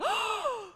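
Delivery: sharp, sudden intake of breath; startled gasp. Acted. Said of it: "she turned around, and she was like".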